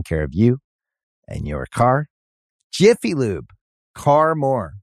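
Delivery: ad read. Acted.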